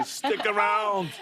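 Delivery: high, drawn-out action-hero line; shouted movie catchphrase. Retold or imitated. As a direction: call out the famous line, stretched slowly. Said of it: "all those lines".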